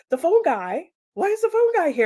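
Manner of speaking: can't believe the phone guy is here; husband asking his wife, high and incredulous; baffled surprise. Retold or imitated.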